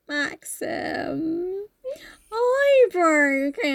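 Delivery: high, drawn-out fake wail; hammed-up tearful squeak; mock whimpering confession. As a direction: whine it slowly like a guilty child. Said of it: "And she's like".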